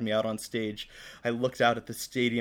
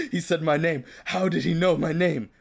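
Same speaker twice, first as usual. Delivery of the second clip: higher, louder, hammed-up fan swoon; 6 semitones higher, 7 dB louder than usual. A narrator's reading. Fan thrilled his idol knows him, mock swooning. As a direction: gush it like a smitten fan. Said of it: "I swooned".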